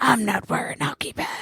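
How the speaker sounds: whispered growl of a masked hero; Batman. Acted. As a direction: rasp it in an unvoiced hero whisper. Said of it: "to do that voice".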